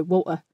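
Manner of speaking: "water" broken by a glottal stop; Cockney "wa'er" for "water". Shown as a demonstration. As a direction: drop the T of "water" for a throat catch. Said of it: "in your Cockney accent".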